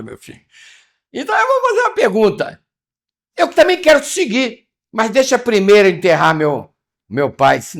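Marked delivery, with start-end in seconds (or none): 1.1-2.7 much higher, a bit louder, playful put-on; mock high voice. Preacher repeats his question to the congregation in a high mock voice. Same line said twice, differently. 3.4-7.6 higher, louder voice of a hesitant follower; pleading disciple. Acted. Preacher voices the disciple asking Jesus to bury his father first, higher.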